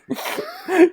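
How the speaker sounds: high squealing laugh bursting out; burst of laughter. Real, not acted. Barkeep player cracking up at the tab offer, squealing laugh. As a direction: crack up at the bribe.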